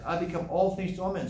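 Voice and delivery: mocking the misused verse; mock proud quoting. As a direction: toss it off like a smug excuse. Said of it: "Some people say".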